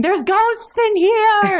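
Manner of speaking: high, slow, loud panicked cry; panicking. Acted. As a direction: wail it out in full panic. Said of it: "Scar starts panicking".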